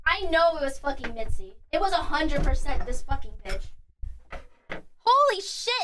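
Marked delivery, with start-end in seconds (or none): 4.7-5.7 higher and slow, drawn out in shock; stunned slow gasp. Real, not acted. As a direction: let the damage sink in. Finds chewed copper wire.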